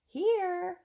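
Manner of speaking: high, loud little call; piping answer. Acted. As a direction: pipe up brightly from nowhere. Invisible boy answers father.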